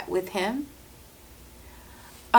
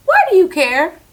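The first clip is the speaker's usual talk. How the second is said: retold sharp question; 10 semitones higher, 8 dB louder than usual. Confronting coworker, retold.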